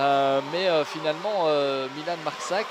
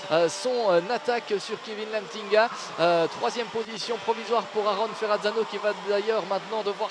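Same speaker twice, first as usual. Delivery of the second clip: higher and louder, rising excitement; excited commentator; 6 semitones higher, 4 dB louder than usual. Acted. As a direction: build the call as the battle heats up. Praising the overtake live. (commentaire de course exalté)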